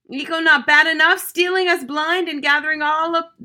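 high, loud angry shriek; furious scream. Acted. Mother screams at the boy stealing food, furious shriek. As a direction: tear into the thief at full volume.

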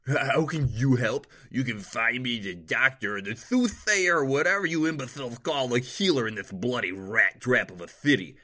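arrogant nobleman. A narrator's reading. Nobleman scorning the city's healers, loud and haughty. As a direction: spit the insults like a spoiled lord.

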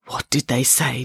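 ordinary delivery

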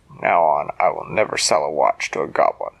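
put-on a solemn vow from the old watchmaker; great-grandfather voice.

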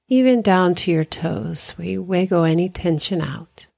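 unhurried meditation guide with long pauses; guided meditation. Acted. Hypnotherapist guiding listeners through body relaxation, calm guided meditation. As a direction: ease them through breathing and relaxing, leaving space after each phrase.

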